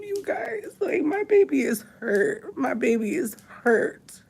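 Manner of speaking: high, breaking, sobbing through the words; crying. Real, not acted. Mother crying on live about her hurting son: high, tearful. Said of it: "I'ma cry it out on live".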